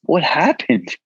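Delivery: slow, high, stunned imitation; worried disbelief. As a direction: voice friends shocked at his state, slowly. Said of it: "And they're like".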